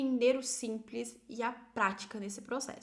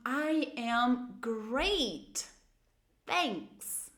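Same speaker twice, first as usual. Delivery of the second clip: very slow, with "great" drawn out; slow intoned model; much slower than usual. Shown as a demonstration. Teacher models an answer with intonation, very slowly. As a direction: stretch each word with full intonation. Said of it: "Lembrem de usar a entonação".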